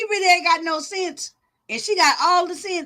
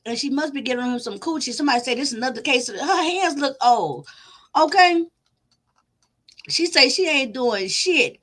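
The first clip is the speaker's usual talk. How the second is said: ordinary delivery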